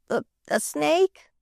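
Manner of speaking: slow, scared rising snake?; frightened snake question. A narrator's reading. Boy asking the maid about a snake, mildly frightened. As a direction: ask snake? timidly, a touch afraid.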